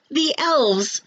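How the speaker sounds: put-on a child spotting the elves; excited child. A narrator's reading. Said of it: "shouted one of the children".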